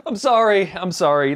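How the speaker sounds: laughing. Real, not acted. Laughing after the clip.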